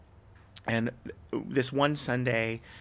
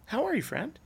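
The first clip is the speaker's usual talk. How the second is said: high, friendly hello; friendly greeting; 10 semitones higher than usual. Retold or imitated. Pastor dad greeting a stranger on the street, high and friendly.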